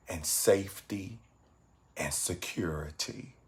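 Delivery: soft, unhurried meditation voice with long pauses; guided meditation. Acted. Meditation teacher guiding evening viewers to reflect on goodness, guided meditation. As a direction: guide them gently into reflection, low-key and calm.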